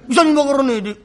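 ordinary delivery

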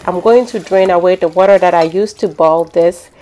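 ordinary delivery

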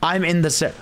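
usual speaking voice

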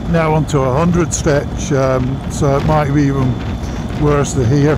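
muffled behind visor; speaking while riding motorbike; talking inside a helmet. Real, not acted. Seen 5: Rider wears closed full-face helmet, visor down, throughout the ride.

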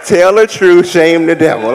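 a touch of a preacher calling out; mock sermon line. Church service call, preacher style.

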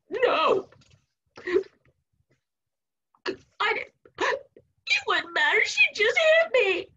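high-pitched whimpering little boy, hammed up; mock sobbing. Boy protesting to his mom, mock sobbing. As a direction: wail it in a squeaky, gasping child's voice.

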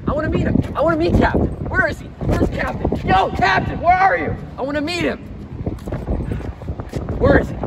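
worked-up demand rising to a shout; impatient calling out. Acted. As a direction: call out for someone who is hiding from you. Demands and calls for the Captain.

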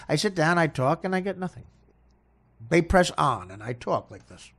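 ordinary talk

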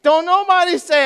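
high, loud preaching punch; emphatic shout. Real, not acted. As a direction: shout it to the congregation.

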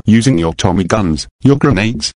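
usual speaking voice

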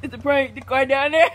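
giggling through the confession; real amused cackling; laughing reveal. Real, not acted. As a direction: let laughter break into the words. Revealing prank while laughing.

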